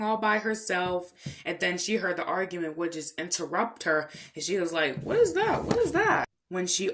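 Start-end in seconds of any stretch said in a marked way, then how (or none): 5.0-6.2 high-pitched mock alarm; high acted puzzled reaction; startled character. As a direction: ask it in a high, put-on cartoon voice. Interrupted character reacts to the noise.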